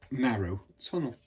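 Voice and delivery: ordinary delivery, nothing marked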